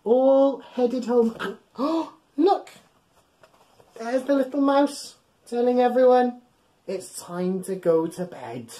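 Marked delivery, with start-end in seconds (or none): none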